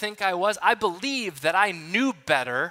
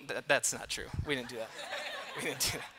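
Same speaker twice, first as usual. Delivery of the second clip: laughing; 5 semitones lower, 11 dB quieter, faster than usual. Real, not acted. Admits the cheese-curd joke.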